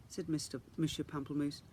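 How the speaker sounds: ordinary delivery, nothing marked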